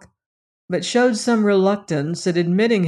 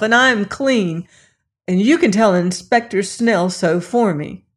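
fierce defiance; 3 semitones higher, 4 dB louder than usual. Acted. Lilas defies the policeman, fierce and loud. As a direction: fight him off with every word. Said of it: "she ran on fiercely".

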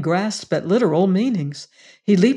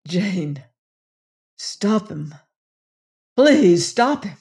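gasping plea; slower than usual. A narrator's reading. Venters begging Jane, gasping then crying out. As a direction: plead breathlessly, rising to a cry. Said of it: "gasped Venters".

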